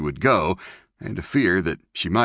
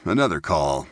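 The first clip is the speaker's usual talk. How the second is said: ordinary delivery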